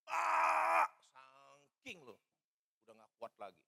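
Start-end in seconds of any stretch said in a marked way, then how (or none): 0.1-0.8 loud, high scream. Retold or imitated. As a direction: let out one piercing, sustained scream. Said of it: "saya teriak".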